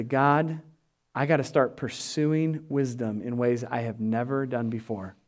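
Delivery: earnest whispered-down plea; hushed prayer. Acted. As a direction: pray it low and intimate. Quiet earnest prayer in sermon.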